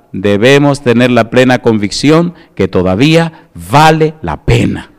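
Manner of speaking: usual speaking voice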